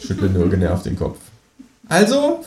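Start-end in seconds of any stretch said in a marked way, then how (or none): 1.9-2.4 very high, expectant creature voice; Känguru. A narrator's reading. Kangaroo waits for companion to sing, high expectant voice. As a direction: prompt expectantly, pitched right up. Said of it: "fragt das Känguru".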